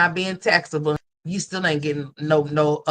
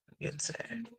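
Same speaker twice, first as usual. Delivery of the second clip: whimpered sad; 7 semitones higher, 17 dB quieter than usual. Real, not acted.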